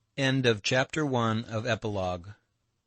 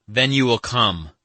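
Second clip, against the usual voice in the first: loud, higher, decisive statement; firm; 4 semitones higher, 8 dB louder than usual. A narrator's reading. Alyosha seeing Katya's tears, firmly insisting. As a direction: state it firmly, allowing no refusal. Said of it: "said Alyosha firmly".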